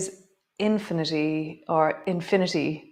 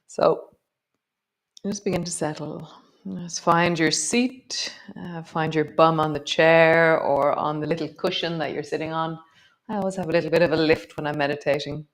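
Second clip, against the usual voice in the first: guided meditation. Acted. Yoga teacher guiding the class into breath, calm meditation voice. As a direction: settle them into the breath.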